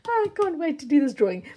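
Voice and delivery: high, loud and giggly with glee; bubbling over about the drawing; excited. Real, not acted. Crafter giddy about her giveaway drawing, excited. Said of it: "I'm so excited".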